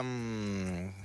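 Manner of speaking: ordinary talk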